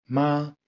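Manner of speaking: MA held high and level; first tone. Shown as a demonstration. Teacher shows learners the first Chinese tone, high and held. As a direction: say "Ma" on a high, sustained, even pitch. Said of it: "haut et tenu".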